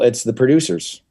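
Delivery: ordinary talk, nothing marked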